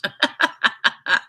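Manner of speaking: breathy burst of laughter; real laugh. Real, not acted. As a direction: laugh loudly in quick bursts. Amused by being called angry Irish.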